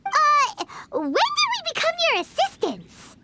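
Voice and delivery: startled protest at being roped in; suddenly drafted as an assistant, taken aback; surprised objection. Real, not acted. Paimon objects to being recruited.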